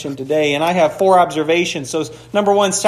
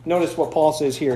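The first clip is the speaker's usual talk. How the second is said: normal talking voice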